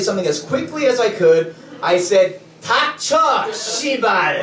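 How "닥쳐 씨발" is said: high, punched-out mock curse; retold angry outburst. Passenger snapping at cab driver, acted anger. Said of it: "just in my anger".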